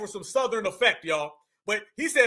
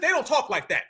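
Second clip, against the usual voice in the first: ordinary talk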